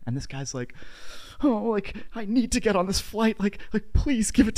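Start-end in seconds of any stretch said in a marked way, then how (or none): 1.4-4.5 imitating a whiny, pleading man, higher. Stranded passenger begging the gate agent for seats, mock high whine.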